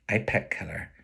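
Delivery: plain reading voice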